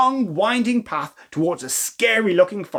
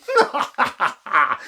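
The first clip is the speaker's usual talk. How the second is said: fairy laughing; 3 semitones higher than usual. Acted. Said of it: "The fairy just laughed at them".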